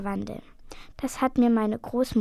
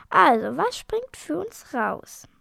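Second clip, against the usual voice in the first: higher, slow, insistent bargainer; wheedling dragon; 5 semitones higher, 4 dB louder, slower than usual. A narrator's reading. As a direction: press for payment, drawing out the words. Nancy presses for a reward. (fordernder Drache, hoch und gedehnt)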